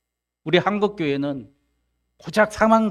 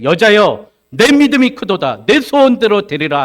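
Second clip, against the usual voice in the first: loud, high-pitched declaration; ringing proclamation; 6 semitones higher, 11 dB louder than usual. Acted. Jesus answers the pleading woman, loudly proclaiming. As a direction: call it out with full authority.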